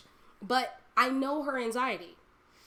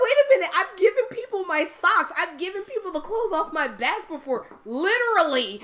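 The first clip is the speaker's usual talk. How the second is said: high, loud, playful outrage; affronted, pitched up and emphatic; indignant protest; 9 semitones higher, 9 dB louder than usual. Retold or imitated. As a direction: protest your generosity at full volume. Retells protesting against being called inconsiderate.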